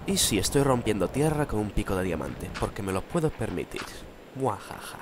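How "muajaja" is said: mustache twirling villain. Acted. Gloats about diamond pick.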